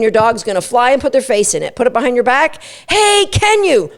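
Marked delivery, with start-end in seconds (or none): 2.9-3.9 high, slow, sing-song "Hey, can you?"; excitable cue. Shown as a demonstration. Trainer demoing the muzzle cue, excitable. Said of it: "say it in a really excitable way".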